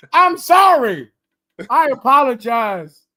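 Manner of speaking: real laughter in the apology; laughing apology. Real, not acted. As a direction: apologize while still laughing. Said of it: "I should not have laughed at that".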